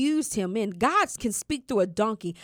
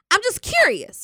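innocent curiosity; 11 semitones higher, 6 dB louder than usual. Retold or imitated. Retelling own plea to mother.

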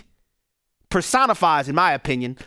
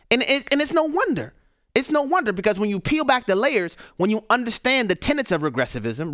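normal talking voice

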